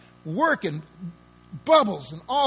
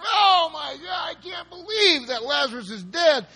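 mock wailing; 9 semitones higher than usual. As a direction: wail it out like a mourner throwing himself down. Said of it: "twisting and turning and hollering".

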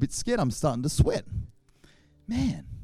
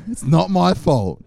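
slow, much louder insistence; mock-defiant louder not-my-fault; emphatic protest; 8 dB louder, slower than usual. Real, not acted. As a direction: hammer the words home to the directors. Pleading to daycare directors.

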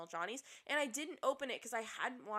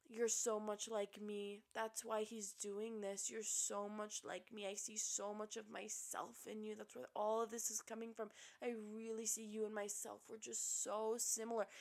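mock intense devotion; 7 dB quieter than usual. Host mimics a contestant fawning over her rival, soft mock devotion. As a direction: imitate hushed, obsessive bonding. Mimicking an obsessed woman's gushing.

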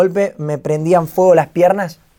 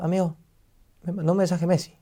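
softer, stunned disbelief, relived; voice of the moment; 7 dB quieter than usual. Retold or imitated. Streamer tells friend of star's message, hushed and stunned. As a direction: say it hushed, as if still in shock. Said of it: "así, con esa voz".

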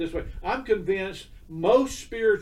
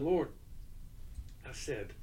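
retold tearful prayer; 8 dB quieter, slower than usual. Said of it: "and I'm crying".

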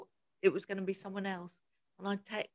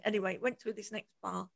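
ordinary talk, nothing marked

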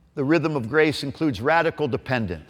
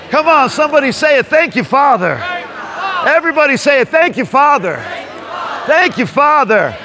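shouting; 11 semitones higher, 7 dB louder than usual. Real, not acted. Urging crowd to shout.

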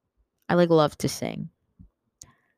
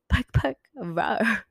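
higher, slow play bark and growl; mimicked barking; 5 semitones higher, slower than usual. Repeating the biting noises for listeners, mimicked barks. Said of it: "It was like".

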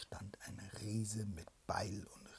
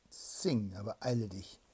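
impatient command; 10 dB louder than usual. Acted. Princess commanding.

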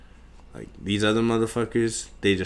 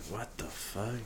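whispered disbelief; 14 dB quieter, slower than usual. Retold or imitated. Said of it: "I'm like".

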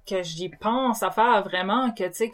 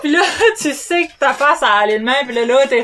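laughing; 5 semitones higher, 7 dB louder than usual. Real, not acted. Laughing while telling the story.

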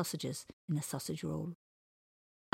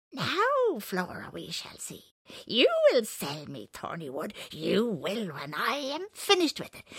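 menacing villain voice; 4 semitones higher, 5 dB louder than usual. Retold or imitated. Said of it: "He's saying".

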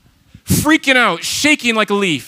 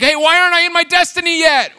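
high, loud whiny demand; a high petulant complaint; 10 semitones higher, 3 dB louder than usual. Pretend. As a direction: whine like a spoiled kid. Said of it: "You're like".

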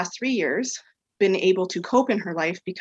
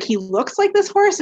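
surprised question; 13 semitones higher, 5 dB louder than usual. Retold or imitated. Stunned by the client's story.

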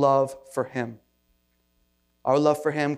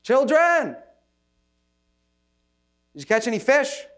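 high, loud call across the water; 11 semitones higher, 5 dB louder than usual. Retold or imitated. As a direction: call out to the boat from the shore. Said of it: "and he yells out".

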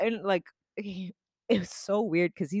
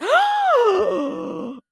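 loud, high, drawn-out gasp; shocked gasp; 8 dB louder than usual. Retold or imitated. Reading Lonnie's Instagram post about small talk, a loud high shocked gasp. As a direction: gasp in mock outrage.

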